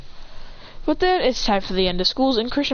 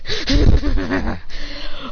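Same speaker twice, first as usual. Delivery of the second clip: mocking laugh; 4 semitones higher, 4 dB louder than usual.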